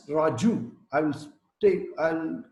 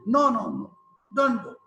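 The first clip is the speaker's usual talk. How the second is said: loud, higher no no no; warning parent; 6 semitones higher, 4 dB louder than usual. Retold or imitated. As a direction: stop the child at the door. Parent stopping kid at door.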